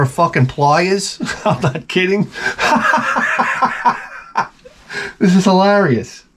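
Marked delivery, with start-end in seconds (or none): 2.3-4.4 high breathless burst of laughter; big laugh. Real, not acted. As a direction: crack up at the absurd gift. Finds pliers in the box.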